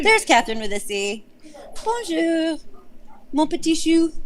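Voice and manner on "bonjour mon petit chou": put-on high, sing-song French greeting in a little old lady voice. Greeting a friend in chat, mimicking the old French lady's high bonjour. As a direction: chirp it like a tiny old French landlady. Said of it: "So I always say it like that".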